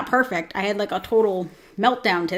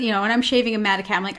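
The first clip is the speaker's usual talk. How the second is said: usual speaking voice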